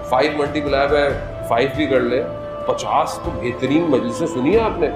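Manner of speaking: ordinary talk, nothing marked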